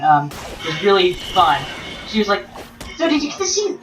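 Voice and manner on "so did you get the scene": lightly voiced friend, raised pitch; asking the girl in line, playful; quoting the friend.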